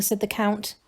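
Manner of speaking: plain reading voice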